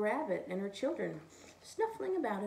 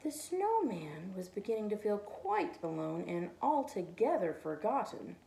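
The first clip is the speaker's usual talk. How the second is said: ordinary delivery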